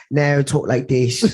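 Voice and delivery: put-on slow, drawn-out British accent; British accent impression. Podcast guest mimicking the actor off screen, British accent. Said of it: "Oh, it's Franklin Saint".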